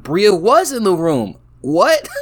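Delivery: sudden high, loud disbelief; surprised. Real, not acted. Player realizing Bria was already in the room, surprised. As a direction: caught off guard by the twist.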